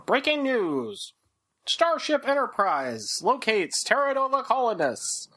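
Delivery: put-on old-timey newsreel announcer; hammed-up vintage newsreel voice; 1950s narrator. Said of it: "old-timey Star Trek newsreel".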